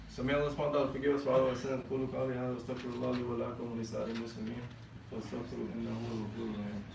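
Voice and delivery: fast, low murmured Arabic recitation; recited prayer. Acted. Imam reciting the khutbah formula to the congregation, quick and hushed. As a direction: rattle off the formula quickly and softly.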